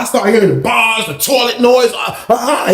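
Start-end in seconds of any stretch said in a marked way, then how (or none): 2.0-2.7 high repeated "ah" cries; wild cries of a man banging up his cell; imitated raging. Inmate wrecking his cell, imitated high cries. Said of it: "He was banging up".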